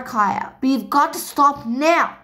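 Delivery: ordinary delivery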